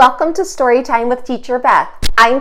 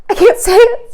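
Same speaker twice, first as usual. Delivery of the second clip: giggling rhino; 12 semitones higher, 6 dB louder than usual. Acted. Rhino too giggly to answer.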